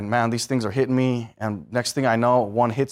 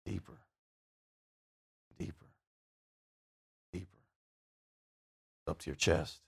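near-whisper, sinking lower; hushed descent; 8 semitones lower, 20 dB quieter than usual. Acted. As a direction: let each deeper sink into a hush.

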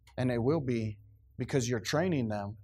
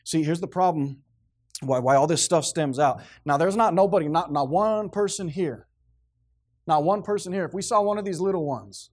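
preaching; 3 semitones higher, 5 dB louder than usual. Acted. Preaching with full force.

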